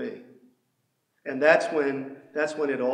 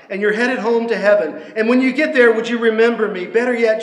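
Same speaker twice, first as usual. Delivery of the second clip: louder, higher cry of a dying thief; pleading criminal; 5 semitones higher, 8 dB louder than usual. Acted. Dying criminal on the cross crying out to Jesus, louder and pleading. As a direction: beg Jesus to remember you, rising in urgency.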